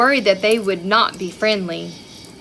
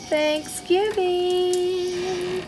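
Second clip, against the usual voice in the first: drawn-out; 8 semitones higher, 4 dB quieter, much slower than usual. Acted. Reading the flap greeting.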